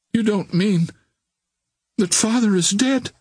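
high, halting dread; fearful question. A narrator's reading. Daughter fearing her father is dead, asking fearfully. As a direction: ask it haltingly, afraid of the answer. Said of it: "a look of terror coming".

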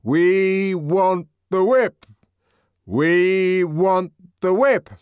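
loud refrain. A narrator's reading. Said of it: "loud, reiterated refrain".

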